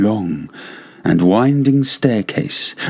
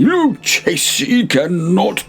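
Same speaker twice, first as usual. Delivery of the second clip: scornful Fortunato; 11 semitones higher than usual. Acted. Fortunato scorns his rival taster.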